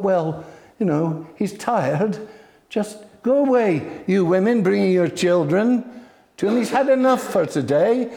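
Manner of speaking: mock shoo the mothers away; irritated disciples. Disciples turning mothers away from Jesus, irritated.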